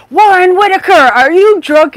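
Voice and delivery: high, loud mock scold of a drunk husband; hammed-up angry Irish mother. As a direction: bark the full name like an angry wife. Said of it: "she's like".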